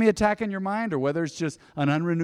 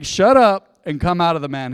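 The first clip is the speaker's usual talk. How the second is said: loud, commanding rebuke; forceful order; 3 semitones higher, 9 dB louder than usual. Retold or imitated. Jesus ordering the demon out of the man, loud command. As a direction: order it out with raised volume. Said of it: "he ordered".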